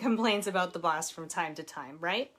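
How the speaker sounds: plain reading voice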